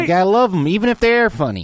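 put-on Southern accent.

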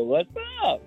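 what's up squeaked up high, unsure; nervous high voice. Real, not acted. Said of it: "Nervous laughter".

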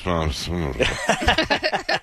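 put-on sullen teen mumble; mocking teen impression. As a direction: grunt it like a moody teenager. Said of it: "he just mumbled something to me in his teenage voice".

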